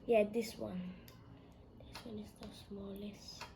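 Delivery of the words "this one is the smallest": normal talking voice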